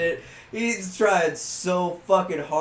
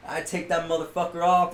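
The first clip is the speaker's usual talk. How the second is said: normal talking voice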